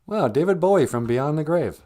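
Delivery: ordinary delivery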